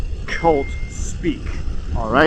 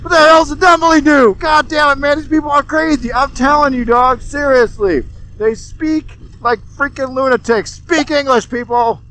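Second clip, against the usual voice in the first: higher, louder exasperated rant; worked up rant; 7 semitones higher, 3 dB louder than usual. Real, not acted. Ranting at cult jargon, higher, louder and exasperated. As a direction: blow up at the nonsense, getting higher. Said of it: "I get a little worked up".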